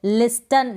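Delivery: लिस्टन with an audible T; wrong pronunciation. Shown as a demonstration. Teacher recapping the mistake, लिस्टन with T sounded. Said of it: "लिसन को लिस्टन ना पढ़ें".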